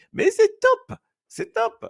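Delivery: high-pitched acted delight; high, gushing mock praise; acted enthusiastic praise. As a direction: exclaim it high, as if thrilled by the food.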